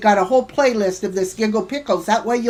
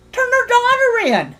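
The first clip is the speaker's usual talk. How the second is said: pitched much higher in disbelief; incredulous echo; 11 semitones higher than usual. Real, not acted. Streamer mocking a rival's threat in disbelief. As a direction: repeat it as if it's absurd.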